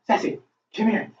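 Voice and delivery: high, coaxing call to the dog. Real, not acted. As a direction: coax the dog over in a high voice. Calling the dog to come.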